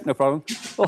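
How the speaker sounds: normal talking voice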